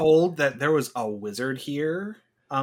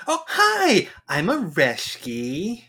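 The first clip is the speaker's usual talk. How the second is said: chirpy greeting; 6 semitones higher, much slower than usual. Acted.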